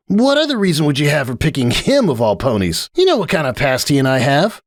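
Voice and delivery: Rainbow Dash. Acted. Rainbow grumbling to Applejack, high scornful tomboy voice. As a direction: drag out the disgust, pitched high.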